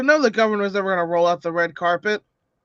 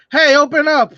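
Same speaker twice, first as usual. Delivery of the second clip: calling out; 7 semitones higher, 5 dB louder than usual. Acted. Voicing character at the wall.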